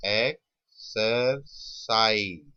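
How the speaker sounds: "Exercise" very slow in three separate pieces; slow sounding-out. Shown as a demonstration. Teacher letting students hear each sound, slow sounding-out. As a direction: break "exercise" apart, pausing after each syllable. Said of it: "जब word बोलोगे अपने आप".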